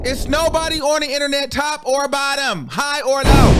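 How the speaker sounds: rally the whole chat, loud and high; hype shout. Real, not acted. Hyping up the viewers, calling out.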